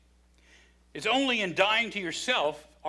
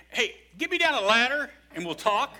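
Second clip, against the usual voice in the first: higher, louder playful call up the pit; mock call up; 7 semitones higher, 5 dB louder than usual. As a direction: holler up for a ladder, joking. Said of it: "I would be like".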